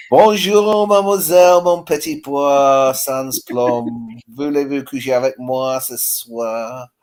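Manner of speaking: slow, drawn-out French patter; French accent. Shown as a demonstration. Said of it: "your French accent".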